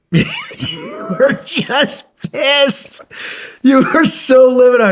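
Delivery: high and laughing through the words. Real, not acted. A friend teasing another about how angry he was, laughing loudly. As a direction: crack up while you tell it.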